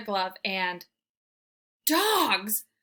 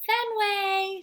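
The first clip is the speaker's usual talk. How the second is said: much higher, sugary coaxing call; even sweeter; 11 semitones higher, 4 dB louder than usual. Acted. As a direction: coax harder with sweetness. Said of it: "even sweeter this time".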